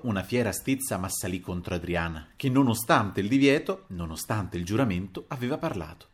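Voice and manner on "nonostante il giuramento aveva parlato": plain reading voice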